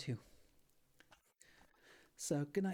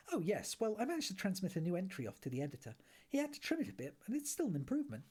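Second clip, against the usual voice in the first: a hint of Ford, higher and breezy; lightly voiced Ford; 7 semitones higher than usual. Ford excusing the Guide to Arthur, lightly voiced higher. Ford's character voice.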